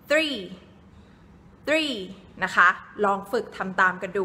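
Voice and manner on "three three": three with the tongue between the teeth; English three. Shown as a demonstration. Teacher showing Thai kids how to say three, clear th. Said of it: "ลิ้นจะต้องอยู่ระหว่างฟันบนกับฟันล่าง และอ่านว่า".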